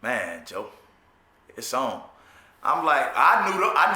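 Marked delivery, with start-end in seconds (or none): 0.0-2.0 low, quiet warning. Retold or imitated. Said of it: "he like".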